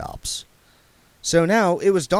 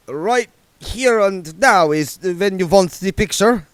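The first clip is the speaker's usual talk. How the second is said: put-on slow, higher, accented anxious query; anxious Otto; 8 semitones higher, 6 dB louder than usual. A narrator's reading. Otto anxiously checking with William, accented and slow. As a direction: let anxiety hang on every syllable. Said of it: "anxiety hanging off every syllable".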